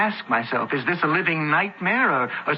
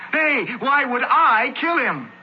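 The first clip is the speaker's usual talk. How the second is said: much higher, louder, slower protest; indignant outburst; 10 semitones higher, 4 dB louder, slower than usual. Acted. Accused Marcus protests to the senator indignantly. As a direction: hurl the question at the senator.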